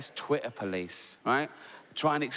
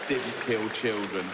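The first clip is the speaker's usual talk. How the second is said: deadpan disbelief; 4 semitones lower, slower than usual. Real, not acted. Repeating her words flatly.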